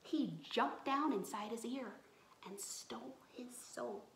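fast hushed storyteller whisper; storyteller whispering about the devil; spooky whisper. A narrator's reading. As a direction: whisper it like a scary secret.

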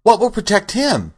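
put-on high, worried girl's question; anxious child pleading for an answer. A narrator's reading. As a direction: pitch it way up with concern. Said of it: "said the girl anxiously".

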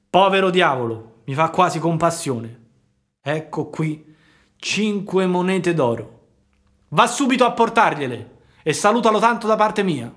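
a softened ogre handing over gold; gruff puppet master, moved. A narrator's reading. Fire-Eater gives coins. (il burattinaio commosso che dona le monete, voce più alta e forte)

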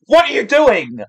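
loud, high-pitched disbelief; incredulous outburst. Real, not acted. Fan scolding the singer fourteen seconds in, incredulous outburst. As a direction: yell it as a scandalised fan.

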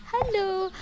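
high, bright call to the rooster. Real, not acted. Vlogger greets her rooster with a high call. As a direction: sing out a hello to the bird.

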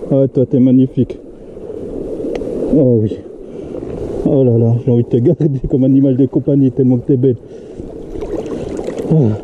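soft, tender talk to the fish. Real, not acted. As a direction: coo to it lovingly.

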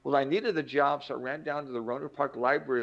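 normal talking voice

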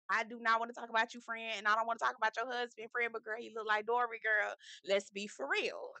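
ordinary delivery

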